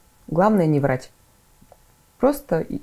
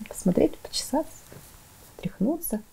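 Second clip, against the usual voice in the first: higher, softer, fading to a whisper; soft puppet narration; 7 semitones higher, 8 dB quieter than usual. Shown as a demonstration. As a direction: murmur lovingly over the doll. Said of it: "ручками она может". (тихо, нежно, до шёпота)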